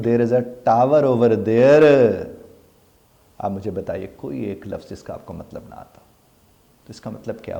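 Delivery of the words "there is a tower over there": very slow, r dropped, clear tower, louder; British English accent. Shown as a demonstration. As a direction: say it the British way, slowly. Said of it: "تو میں کہتا ہوں". (برطانوی لہجے میں آہستہ There is a tower over there)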